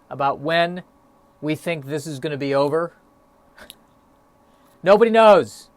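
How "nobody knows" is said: loud, higher, fed-up blurt; exasperated outburst. Real, not acted. Podcaster fed up with pandemic talk, exasperated loud outburst. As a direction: throw it out like you're sick of the question.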